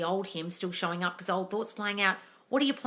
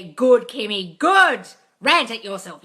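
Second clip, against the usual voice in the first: loud praise; 2 semitones higher, 12 dB louder, slower than usual. Real, not acted. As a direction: cheer the viewer on hard. Praising a viewer.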